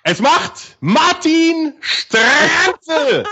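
high, slow fake sobbing joy; mock crying. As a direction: blubber the goal through tears.

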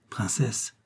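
softly, discreetly; low voice. A narrator's reading. Suitor addressing the princess aside, in a low voice. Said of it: "dit tout bas Korzoff".

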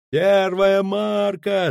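high, long-drawn depth call; loud, slow sailor's holler; sailor's bawled call. A narrator's reading. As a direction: sing out the sounding, stretching each word. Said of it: "заорал матрос с лотом".

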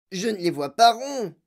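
loud, high put-on voice; Mike T V. A narrator's reading. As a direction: object loudly in a high character voice. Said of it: "dit Mike T V". (protestation forte, voix aiguë)

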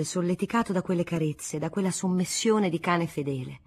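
plain reading voice

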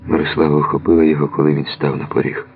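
plain reading voice

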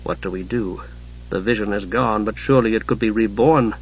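low, soft, dazed self-talk; said to himself. A narrator's reading. Knight wondering what is left, quietly to himself. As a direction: speak low and softly, thinking aloud. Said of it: "Steele said to himself".

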